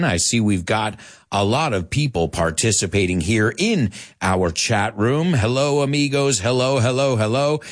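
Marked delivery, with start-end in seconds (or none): none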